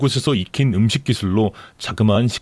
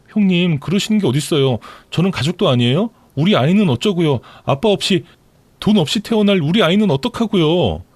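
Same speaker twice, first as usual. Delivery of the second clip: indignant pleading mistress; 6 semitones higher than usual. Acted. Mistress begging sister-in-law for her unborn child, rising plea.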